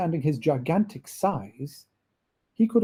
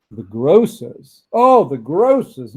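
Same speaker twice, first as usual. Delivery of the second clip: louder and slower, savouring the grocers; rapturous narrator's exclamation; excited delight; 8 dB louder, slower than usual. A narrator's reading. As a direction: marvel at it out loud. Narrator gushing over grocers.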